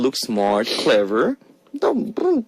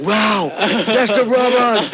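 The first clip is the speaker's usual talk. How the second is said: high, laughing fake wow; mock amazement; 8 semitones higher, slower than usual.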